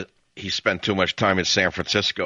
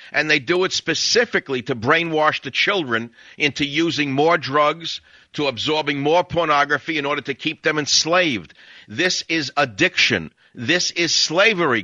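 emphatic, higher-pitched indignant tirade; worked-up radio rant with punched key words; 4 semitones higher than usual. Real, not acted. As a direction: hammer each accusation home, real agitation. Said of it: "I'm a little worked up right now".

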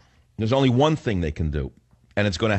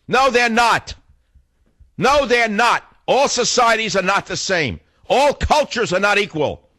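high-pitched, emphatic rebuttal; indignant rant; 10 semitones higher than usual. Real, not acted. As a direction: hammer each denial high, falling at the end. Indignant political rant, rebutting opponents.

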